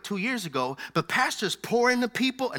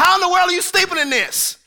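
high, louder disbelieving question; high, louder mock disbelief; incredulous; 10 semitones higher, 7 dB louder than usual. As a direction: act amazed anyone could sleep in this. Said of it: "I'm like".

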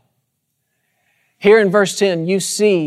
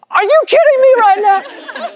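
amazed squeal. Retold or imitated. Tech rep hearing the Copeland name, amazed high squeal. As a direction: squeal like a starstruck fan.

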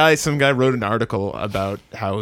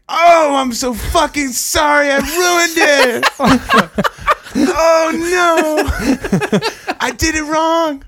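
high, slow, loud wailing with laughter, a surgeon overdoing remorse; hammed-up sobbing sorry, pitched way up; overacted grief, half laughing; wailing apology; 5 dB louder, much slower than usual. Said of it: "He's like".

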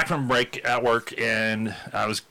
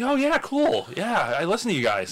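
excited fan; 9 semitones higher than usual. Retold or imitated. Fan voiced in story.

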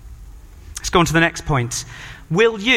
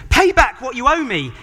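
a hint of a harsh demand, pitched up; 7 semitones higher than usual. Servant demands his debt back from his fellow, mildly harsh. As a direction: press him hard for the money. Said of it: "he demanded".

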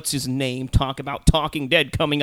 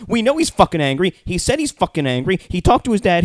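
loud, raised-pitch indignation; exasperated rant; 5 semitones higher, 6 dB louder than usual. Real, not acted. Viewer ranting at the recap host's obvious question, exasperated. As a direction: hammer the obvious, loud and high.

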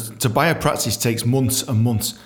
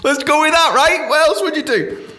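giddy reckless excitement; 4 dB louder than usual. Retold or imitated. Retold reckless inner thought.

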